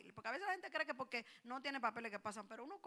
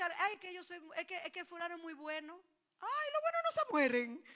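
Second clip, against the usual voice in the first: mock grieving believer; 3 semitones higher, 4 dB louder than usual. Mocking grieving church member.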